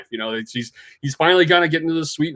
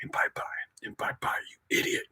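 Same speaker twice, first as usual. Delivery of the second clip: whispered self-reproach, much softer; mad at himself; 14 dB quieter than usual. Retold or imitated. Barry scolding himself walking down the hall, mad whisper. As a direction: mutter it angrily to yourself under the breath. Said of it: "he's just so mad at himself".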